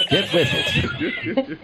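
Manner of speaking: very high, slow, cracking into laughter; laughing squeak. Real, not acted. Comic scolding the Pilgrims, laughing.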